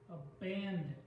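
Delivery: "Abandon" with a held, weighted BAN; quick "a", lean into the "ban" of "Abandon"; stress on second syllable. Shown as a demonstration. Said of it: "the second syllable".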